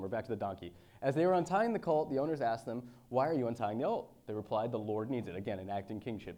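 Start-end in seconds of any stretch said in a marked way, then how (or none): 1.0-5.4 raised, louder preaching read; scripture reading. Acted. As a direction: proclaim the passage to the congregation. Reads scripture aloud.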